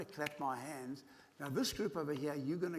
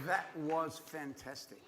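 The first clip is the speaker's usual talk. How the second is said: normal talking voice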